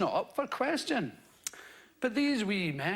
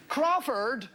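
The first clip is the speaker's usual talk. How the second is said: mock clan member calling; 5 semitones higher, 3 dB louder, slower than usual. Mocking surname clan voices.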